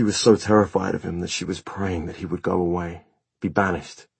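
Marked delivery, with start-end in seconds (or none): none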